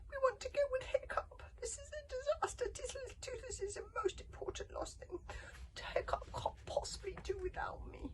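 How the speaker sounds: very high-pitched little dragon voice; squeaky, squealed protest; squealing. A narrator's reading. Little dragon parted from Hiccup, high squealed protest. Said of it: "squealed Toothless".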